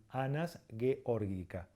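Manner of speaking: "georgica" said slowly as geh-OR-gi-ka; Latin hard G. Shown as a demonstration. Teacher naming the duck in Latin, slow hard g in "georgica". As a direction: say "Anas georgica" slowly, both g's hard. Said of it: "sino que es ga, ge, gi".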